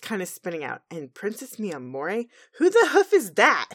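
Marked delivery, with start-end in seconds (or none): 2.6-3.7 loud, high indignant question; indignant outburst. Acted. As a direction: demand it, outraged. Indignant Twilight.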